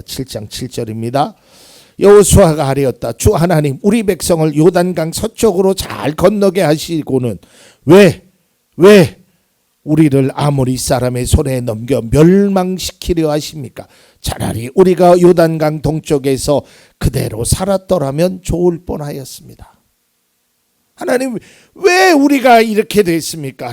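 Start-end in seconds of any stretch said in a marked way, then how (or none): 2.0-19.8 loud, impassioned Bible reading; dramatic scripture reading. Acted. Reads Joshua's prayer aloud. 20.9-23.7 high, loud wail of why; anguished cry. Pretend. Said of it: "라고 절규의 소리".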